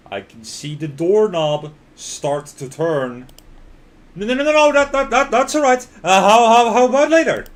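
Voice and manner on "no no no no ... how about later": loud high panicked babble. Acted. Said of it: "The panic in my voice is obvious".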